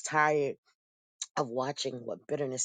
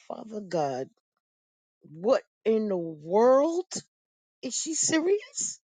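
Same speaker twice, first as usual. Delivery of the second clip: much higher and slower, drawn-out astonishment; stunned disbelief; 6 semitones higher, 5 dB louder, slower than usual. Retold or imitated. To God after her mother's request, slow stunned disbelief. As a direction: stretch out the shock. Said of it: "I said".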